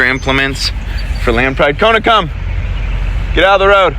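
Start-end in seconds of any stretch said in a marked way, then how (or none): 1.8-4.0 calling the dog. Real, not acted. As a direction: yell her back from the road.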